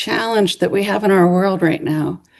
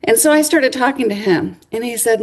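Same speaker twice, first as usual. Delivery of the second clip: ordinary delivery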